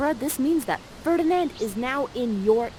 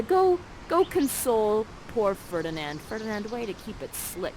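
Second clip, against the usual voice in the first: normal talking voice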